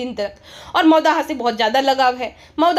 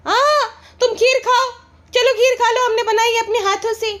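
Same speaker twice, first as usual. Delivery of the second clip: much higher, syrupy put-on wife voice; mock sweet wife; 7 semitones higher than usual. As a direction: coo it like a doting vlogger. Voicing the wife feeding kheer.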